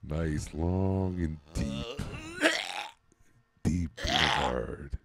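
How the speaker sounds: slow drawn-out breathing instructions; breathing coach. Shown as a demonstration. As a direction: pace him through each breath slowly. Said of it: "coaches breathing exercise".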